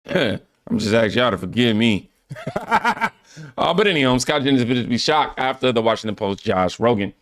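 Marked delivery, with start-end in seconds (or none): 2.3-3.1 high, quick laugh; laugh at oneself. Real, not acted. Host asking forgiveness for stumbles, laughing high. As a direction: burst into a high giggle.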